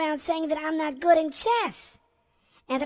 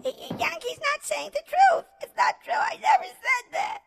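furious denial; 11 semitones higher than usual. Acted. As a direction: voice shoots high into a shout. Boy accused, denies it furiously.

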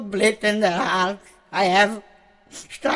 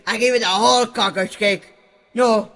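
ordinary delivery

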